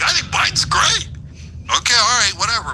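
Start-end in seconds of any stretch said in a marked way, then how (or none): 0.0-1.1 mock lunatic guest. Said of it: "he's like".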